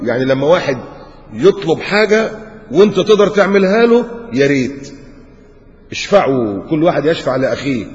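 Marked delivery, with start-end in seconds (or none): none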